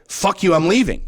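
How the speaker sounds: storming off from wife, angry; angry retort. Retold or imitated. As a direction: spit it out and walk away. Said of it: "I said".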